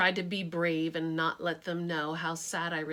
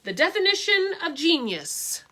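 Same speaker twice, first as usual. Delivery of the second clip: no-nonsense voice; 8 semitones higher, 8 dB louder than usual. Acted. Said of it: "reading in this no-nonsense voice".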